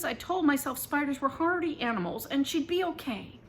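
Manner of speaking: usual speaking voice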